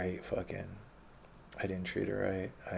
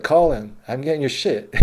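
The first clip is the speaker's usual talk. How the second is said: loud, raised-pitch retort; imitated defiant bouncer; 5 semitones higher, 10 dB louder than usual. Bouncer answering a guest he is evicting, loud defiance. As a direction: throw the threat back loudly, unimpressed.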